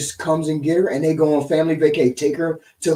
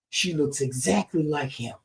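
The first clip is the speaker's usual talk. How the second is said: normal talking voice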